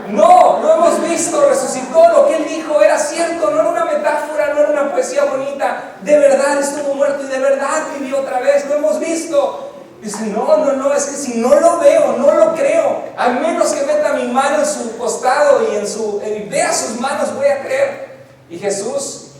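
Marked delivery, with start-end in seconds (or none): 0.1-9.7 insistent shout. Retold or imitated. Disciples insist to Thomas. 10.2-18.2 stubborn protest. Retold or imitated. Stubborn Thomas refuses.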